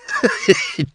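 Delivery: high foolish giggle; drunken giggle. A narrator's reading. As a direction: giggle at your own nasty joke. Drunk man giggles at own joke.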